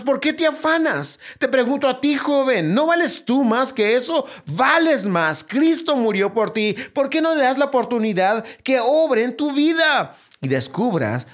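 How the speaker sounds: rising fervour of a revival sermon; fervent preaching. Acted. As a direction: preach it at full force to every brother and sister. Preaches at full force.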